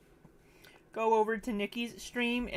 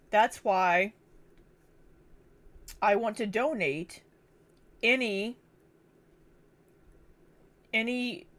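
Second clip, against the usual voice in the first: talking while eating; mouth full. Real, not acted. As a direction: speak around a bite of food. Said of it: "I'm eating food".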